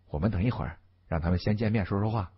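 low, soft and quick; a discreet, quiet murmur to a companion; hushed aside. A narrator's reading. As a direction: keep your voice down, hurried. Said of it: "小声说道".

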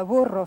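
"burro" with a rolled R; Spanish "burro", R rolled on the tongue; trilled Spanish R. Shown as a demonstration. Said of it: "that's got that trilled R".

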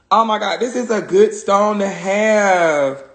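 ordinary talk, nothing marked

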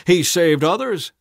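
loud, high sneer; scoffing. A narrator's reading. Elders scoffing at Jesus, loud high sneer. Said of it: "they scoffed".

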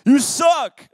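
high, loud jeer; loud, comic giant's insult; calling out. Acted. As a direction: lob a taunt across the field. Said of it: "he starts calling out".

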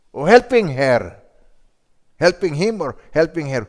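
high, loud sermon push; fiery preaching. Real, not acted. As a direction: drive the point home. Preaching at full force.

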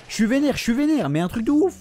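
high-pitched, worked-up venting; really pissed off. Real, not acted. Player venting that the game enrages him, pissed off.

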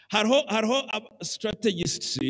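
usual speaking voice

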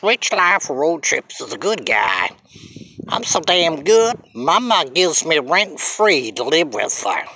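usual speaking voice

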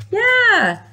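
excited gasp. Acted. Excited reveal to children.

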